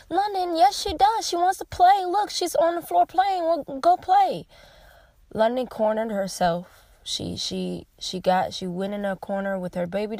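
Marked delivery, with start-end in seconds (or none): 0.1-4.5 raised, coaxing reassurance; comforting mother. Retold or imitated. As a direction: soothe a crying child into playing. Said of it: "I had to rub her and I had to say".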